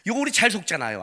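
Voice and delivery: ordinary delivery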